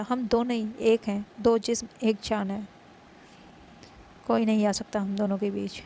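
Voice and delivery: loud, firm threat to a rival; loud possessive warning; stern warning. Acted. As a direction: warn her off, hard and loud. Rival warns her off.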